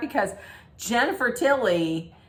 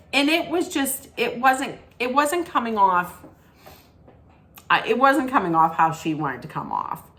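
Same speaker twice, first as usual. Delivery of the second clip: usual speaking voice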